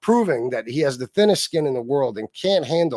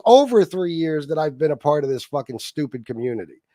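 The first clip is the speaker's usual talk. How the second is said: ordinary delivery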